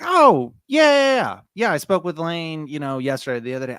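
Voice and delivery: high, loud mock homeowner gushing yes; a hint of a chatty seller; eager seller. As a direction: play her happily remembering the call. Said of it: "she'd be like".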